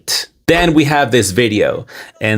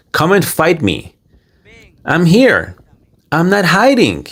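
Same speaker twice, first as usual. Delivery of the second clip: higher-pitched mock defiance, not a real shout; half-hearted angry taunt, raised high; imitating an angry challenge; 7 semitones higher than usual. Narrator mimicking the banned man daring his haters, mock angry. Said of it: "I mean, he's angry".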